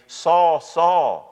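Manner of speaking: loud, high, drawn-out name call; ringing, commanding summons; emphatic call. Retold or imitated. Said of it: "That's very emphatic there".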